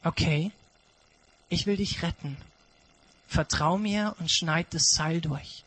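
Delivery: a gentle command to trust; quiet reassuring God; calm God voice. Acted. God answers the climber.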